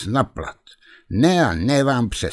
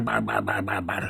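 bar, bar fading much softer into a murmur; quick bar, bar trailing off much softer; 7 dB quieter than usual. A narrator's reading. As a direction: let the bar syllables die away quietly. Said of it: "Barbucha vám říkal stejně rychle".

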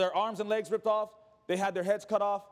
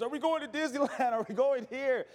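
put-on higher-pitched eager child questions; excited kids; 6 semitones higher than usual. Kids guessing at the surprise destination, mock excited. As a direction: imitate children bubbling with guesses.

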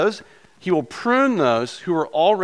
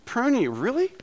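high, incredulous "Prune you, really?"; mock disbelief; 9 semitones higher, 3 dB quieter than usual. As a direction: act shocked at the idea of being pruned. Preacher's mock disbelief at pruning.

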